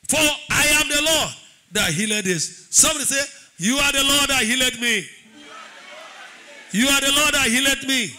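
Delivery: loud, forceful healing declaration; preaching declaration. Real, not acted. Preacher leading the congregation to claim healing, forceful declaration. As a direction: lead the crowd with full-voiced conviction.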